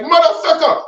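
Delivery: high-pitched comic catchphrase; mock exclamation in a high put-on voice; Michael Blackson. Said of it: "he be like".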